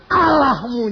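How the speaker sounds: loud, choked cry of a word; tearful cry. Real, not acted. Preacher crying out God's name, choked and loud. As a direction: let the word break into a cry. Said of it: "saya nangis".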